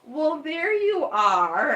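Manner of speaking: loud, high, stretched-out sing-out; mock hands-on-hips greeting. Vet rounding the corner to a famous dog, exaggerated hands-on-hips greeting. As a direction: imitate a vet making a big show of spotting a favorite. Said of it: "puts her hands on her hips and she goes".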